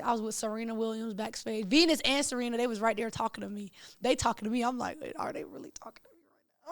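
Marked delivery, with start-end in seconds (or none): 5.0-6.7 hushed, quick, disbelieving aside; retold awestruck whisper, hurried and very soft; starstruck whisper. Fan backstage with Venus and Serena, retelling her awed whisper.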